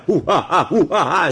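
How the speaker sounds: laughing hoo ha ha chant. Retold or imitated. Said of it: "I'll add my own rendition".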